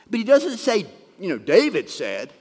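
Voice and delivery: high, loud stress on doesn't; pointed emphasis. Real, not acted. Stresses what the writer avoids.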